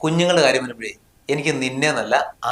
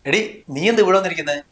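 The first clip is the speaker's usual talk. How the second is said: higher-pitched worried scolding; 5 semitones higher than usual. Acted. Husband scolding wife for leaving the baby alone, raised and alarmed. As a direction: tell her off, alarmed and raised.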